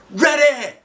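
high loud cry; shouted. Acted. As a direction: yell back eagerly. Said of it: "I shouted".

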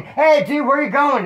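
high, loud retold call; calling after someone. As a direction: call out to a friend running off. Calls after a fleeing friend.

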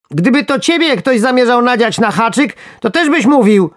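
high, loud, squeaky worm in a rage; furious snort. A narrator's reading. Worm protesting at being hooked, furious outburst. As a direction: squeal indignantly as an enraged little worm. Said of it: "parsknął z wściekłością".

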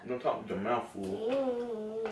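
put-on high, soft, slow baby-talk scolding. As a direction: gently scold a toddler in sing-song. Parent scolding baby gently.

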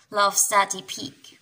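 usual speaking voice

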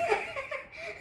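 short high giggle; quick breathy laugh. Real, not acted. Laughs at her own intro.

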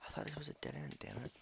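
whispered grumble; quiet annoyed whisper; frustrated whisper. Real, not acted. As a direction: whisper it in disgust.

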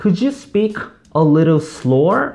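very slow, drawn-out English; slow demo request. Shown as a demonstration. Teacher models asking a fast talker to slow down. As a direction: stretch each word out. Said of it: "어떻게?".

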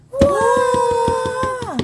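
long, very high whooping cheer; excited cheer. Pretend. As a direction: stretch out a giddy party cheer. Mock celebration at cake cutting. (길게 늘인 신난 환호)

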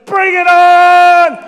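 very loud, high, drawn-out battle cry; heroic shout. Retold or imitated. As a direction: throw yourself in front and roar defiance. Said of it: "puts his arms out and says".

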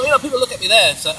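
ordinary delivery

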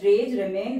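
normal talking voice

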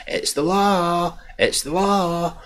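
high, drawn-out mimicry of a nagging refrain; mocking women's refrain. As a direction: stretch it out high, mocking them. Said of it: "That's what women keep saying".

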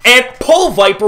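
normal talking voice

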